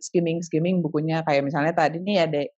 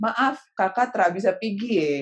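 put-on lightly done Papuan accent; 4 semitones higher than usual. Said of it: "Apalagi yang".

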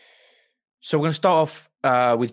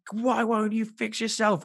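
higher, slow frustrated plea; frustrated Rachel; 9 semitones higher, slower than usual. Retold or imitated. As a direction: plead with the broken TV. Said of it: "she's like".

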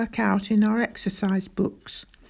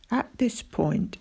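ordinary delivery, nothing marked

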